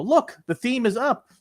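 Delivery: a chirpy agent proud of nothing; mock agent. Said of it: "They go".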